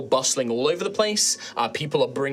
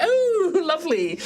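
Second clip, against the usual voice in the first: very high, swooning gasp of delight; a flattered grandma melting; delighted old lady; 5 dB louder, slower than usual. Acted. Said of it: "she's like".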